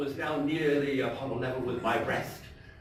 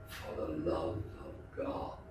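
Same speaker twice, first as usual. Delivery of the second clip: much lower, softer, flat echo; quiet mockery of the plea; cold mocking echo; 10 semitones lower, 9 dB quieter than usual. A narrator's reading. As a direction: hand his plea back to him icily. Montresor coldly echoes the plea.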